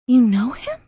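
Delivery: first stunned take; shocked question. Acted. J C learning Max knows Jerry, stunned rising question. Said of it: "she squealed".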